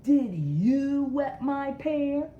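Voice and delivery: high, loud, slow demand; indignant accusation. Acted. Reuben confronting Bigfoot, loud indignant demand. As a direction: accuse him, stretching every word.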